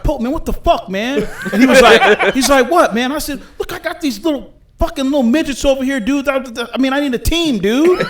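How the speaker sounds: high, laughing mock-furious pleading to the league boss; laughing mock outrage. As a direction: replay his own indignant plea while cracking up. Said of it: "I was pissed".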